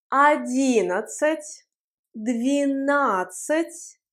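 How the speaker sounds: двенадцать said slowly for the class to repeat; slow choral model. Shown as a demonstration. Teacher to class, slow repeat-after-me pace. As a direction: pace двенадцать slowly so everyone can follow. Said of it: "Prononçons ensemble".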